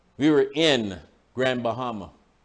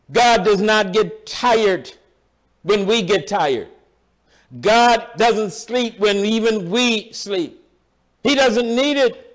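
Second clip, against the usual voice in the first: preaching at full force; 6 semitones higher, 4 dB louder, slower than usual. Acted. Building preaching about God.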